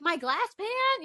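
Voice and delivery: high, slow outraged question; indignant disbelief. Retold or imitated. Daughter learning her pan shattered, high slow disbelief. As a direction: stretch it out in disbelief.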